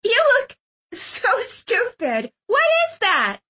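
high, drawn-out gleeful ridicule; acted giggly girl's voice; giggling mockery. A narrator's reading. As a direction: make fun of him, slowly and high. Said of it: "giggling maniacally".

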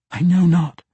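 high, loud captive woman; anxious denial. A narrator's reading. Captive woman answers the assassin's questioning, anxious denial. Captive denies knowledge to interrogator.

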